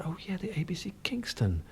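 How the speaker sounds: hushed recollection. Retold or imitated. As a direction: breathe it out as a memory comes back. Retold moment of remembering, half whispered.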